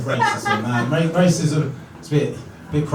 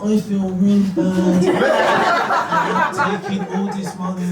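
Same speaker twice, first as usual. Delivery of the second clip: bullshit apology; 3 semitones higher than usual. Acted. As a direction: drag out a fake public apology. Acted insincere TV apology.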